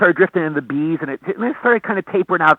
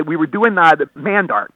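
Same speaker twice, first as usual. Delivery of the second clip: normal talking voice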